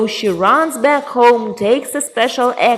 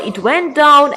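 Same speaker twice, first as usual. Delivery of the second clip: ordinary delivery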